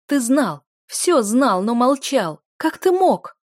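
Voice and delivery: loud, high, rising outrage; anguished accusation. A narrator's reading. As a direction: hurl the accusation, climbing to a high cry. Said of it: "Мой голос дрогнул".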